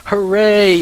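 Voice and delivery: mock cheer. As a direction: cheer your own misfortune in jest. Mock cheer at being lightheaded.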